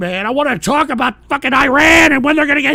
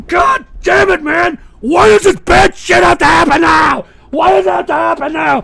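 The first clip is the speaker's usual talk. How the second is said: exasperated yelling; 3 semitones higher than usual. Real, not acted. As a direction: wail the complaint in rage. Despairing rage at the mess.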